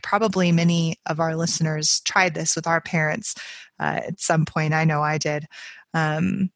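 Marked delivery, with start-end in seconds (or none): none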